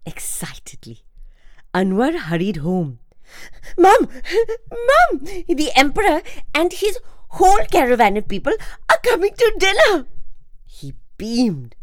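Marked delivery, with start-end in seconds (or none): 3.8-10.1 high, loud excited calling for Mum; excited shout. A narrator's reading. Boy rushes home shouting to his mum, excited. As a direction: burst in calling out with news.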